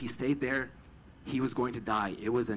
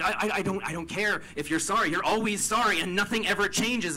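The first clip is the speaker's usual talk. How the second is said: higher, louder, fed-up retort at her brother; raised, exasperated voice with stammered start; exasperated outburst; 9 semitones higher, 5 dB louder than usual. Retold or imitated. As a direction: throw it back at him, sick of the apologies. Arguing with brother about apologies.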